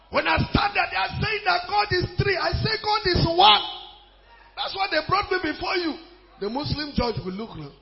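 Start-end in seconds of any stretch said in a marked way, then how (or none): 0.1-5.9 high-pitched courtroom declaration; impassioned preacher defending himself; defiant preaching. Acted. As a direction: argue the case at a high pitch. Enacts his defiant courtroom speech.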